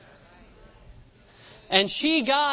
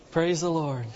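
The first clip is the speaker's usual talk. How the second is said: ordinary talk, nothing marked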